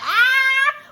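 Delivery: a high, loud delighted shriek; excited shriek. Retold or imitated. Viewer reacting to the pixie cut, a high excited squeal. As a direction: squeal like a thrilled fan. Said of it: "I was like".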